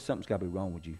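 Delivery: a soft, hushed imitation of the wife's concern; imitated worried wife. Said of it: "She says".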